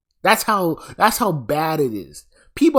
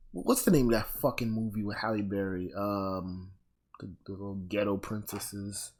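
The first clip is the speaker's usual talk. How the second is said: low, soft thinking aloud; muttering to self; 8 semitones lower, 11 dB quieter than usual. Real, not acted. Trying to recall a Halle Berry movie, muttered low. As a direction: drop low and quiet, searching memory.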